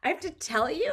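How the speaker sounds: imitating a son's put-out, incredulous question; teen attitude. Mother imitates her son on curfew, put-out attitude. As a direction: toss it back like a grown kid offended at being asked. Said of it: "his attitude was like".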